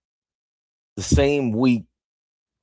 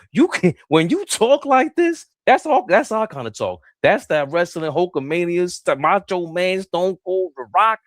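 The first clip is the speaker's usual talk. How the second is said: hyped up; 5 semitones higher than usual. Real, not acted. Wrestling promo hype.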